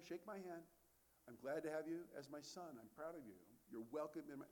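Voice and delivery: soft, tender father forgiving his son; gentle heavenly Father. Acted. Father gently forgiving his repentant son, soft and tender. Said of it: "But your heavenly Father says".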